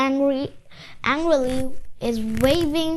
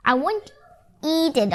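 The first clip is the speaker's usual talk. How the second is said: usual speaking voice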